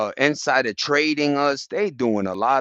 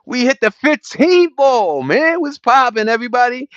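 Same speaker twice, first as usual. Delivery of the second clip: much higher, louder hype greeting; excited shout; 10 semitones higher, 8 dB louder than usual. Real, not acted. Greeting viewers after a win.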